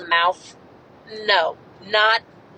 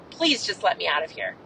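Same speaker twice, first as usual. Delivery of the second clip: softer, lower, tearful plea; retold crying plea; 5 semitones lower, 7 dB quieter than usual. Cornered by a sheriff, a soft tearful plea. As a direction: beg quietly as if about to break down. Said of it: "And I start crying".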